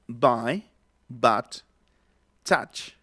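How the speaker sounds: "Buy, but, touch" with a vowel between A and O; intermediate vowel. Shown as a demonstration. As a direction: sound "touch" as "tach". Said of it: "Está como entre la A y la O".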